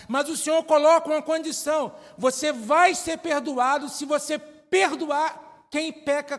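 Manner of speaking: fervent sermon delivery; preaching. Acted. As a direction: preach it out to the church. Preaching build-up.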